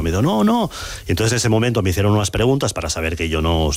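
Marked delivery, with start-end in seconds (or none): 0.2-0.7 high-pitched quoted protest; retold protest. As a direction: imitate someone's high-voiced objection. Retells a reply to his cries.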